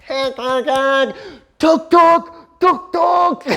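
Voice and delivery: high, loud imitated honk and driver's tuk-tuk call; imitating a driver honking from down the road; mock honking call. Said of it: "all you hear is this".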